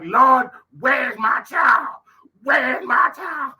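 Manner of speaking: high, slow desperate yell; frantic shout. Retold or imitated. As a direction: cry out for the lost child. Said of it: "I'm like".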